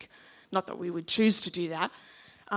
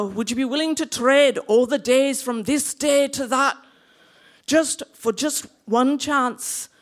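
loud, raised Scottish battle cry; Scottish accent; 4 semitones higher, 8 dB louder than usual. Acted. William Wallace rallying men to fight, loud Scottish accent, building. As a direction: build a rousing Scottish speech to a shout. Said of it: "give my Scottish accent a go".

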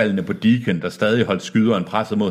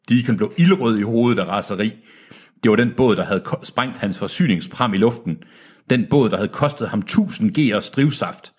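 ordinary delivery, nothing marked

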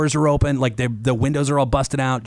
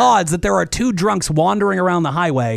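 exasperated; 7 semitones higher than usual. Real, not acted. Exasperated retelling of being framed.

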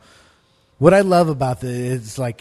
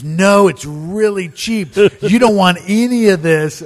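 loud, slow, higher; pushy salesman stoner; 7 semitones higher, 9 dB louder, slower than usual. Retold or imitated. Stoner selling dope to his friend, loud and slow. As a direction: sell it loudly while shooing. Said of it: "He goes".

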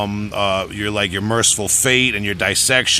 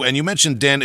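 ordinary talk

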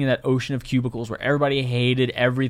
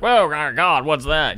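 imagined colleague reacting in alarm, higher and louder; mock shock; 4 semitones higher, 4 dB louder, slower than usual. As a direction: put on a startled, appalled outburst.

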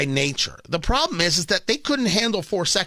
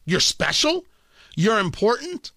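normal talking voice

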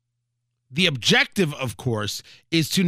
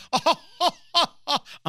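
laughing; 10 semitones higher than usual. Real, not acted. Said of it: "I assume that's how Russians laugh".

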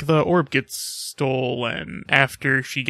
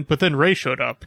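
usual speaking voice

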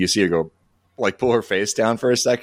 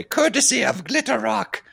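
much higher, hammed-up villain line. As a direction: ham up a cheesy TV villain's catchphrase. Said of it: "supposed to turn to the camera and say".

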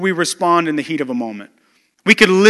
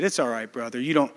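much softer, lower, kind pastor's answer; gracious gentle reply; 7 semitones lower, 7 dB quieter than usual. Retold or imitated. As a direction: ease the man's shame softly. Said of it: "so graciously just smiled and said".

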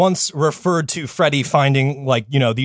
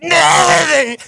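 high shouted anime wail; anime scream; 14 semitones higher, 4 dB louder than usual. Retold or imitated. Friend mocks Goku's anguished scream of loss. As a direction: scream it like Goku losing a friend.

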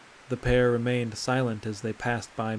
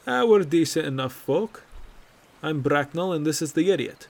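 higher and louder, jovial jab; joking introduction; 4 semitones higher, 5 dB louder than usual. Acted. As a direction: introduce your friend with a grin.

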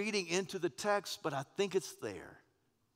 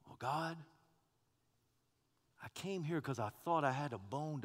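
a hint of complaining prophet; 9 dB louder than usual. Elijah pleading his case to God, building indignant. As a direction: start hushed and hurt, build to protest. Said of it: "the tenor and tone is different".

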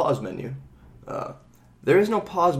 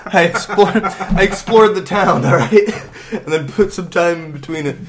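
laughs through the words, louder and higher; real laughter while protesting; laughing; 7 semitones higher, 8 dB louder than usual. Real, not acted. Laughing defending slow play.

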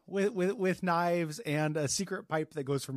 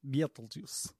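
Russian accent; 2 semitones lower, 6 dB quieter, slower than usual. Shown as a demonstration. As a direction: play a Russian saying it. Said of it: "saying Beetlejuice in a Russian accent".

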